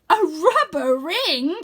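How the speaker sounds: indignant disbelief, drawn out; offended wizard. Acted. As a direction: wizard hurt by the doubt.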